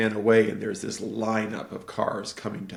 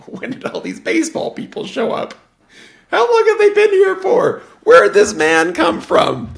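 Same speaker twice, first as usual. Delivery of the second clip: much higher and louder, astonished; shocked brother; 13 dB louder than usual. Retold or imitated. Brother suddenly seeing the ballplayers, high and loud with shock. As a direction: gasp it out as if seeing ghosts. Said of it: "brother is like".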